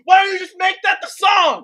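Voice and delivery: very loud, high-pitched outburst; hammed-up indignant holler; exasperated shout. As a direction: yell it in exaggerated frustration. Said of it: "And I was like".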